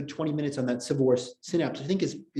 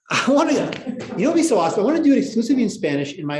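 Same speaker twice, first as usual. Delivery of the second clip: much higher and louder, bursting with the idea; excited; 10 semitones higher, 6 dB louder than usual. Real, not acted. Teacher imagining an all-Spanish class, excited. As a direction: gush about the plan.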